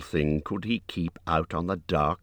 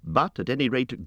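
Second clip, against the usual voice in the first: ordinary delivery, nothing marked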